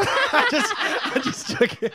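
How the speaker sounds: breaking into laughter, the last words breathed out; laughing. Real, not acted. Finishing the garbage story, laughing helplessly. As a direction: lose it to laughter.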